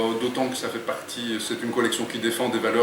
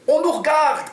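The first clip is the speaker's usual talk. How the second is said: high, loud cry of alarm; mock shout; 6 dB louder than usual. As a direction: yell it while banging the window. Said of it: "crie-t-il".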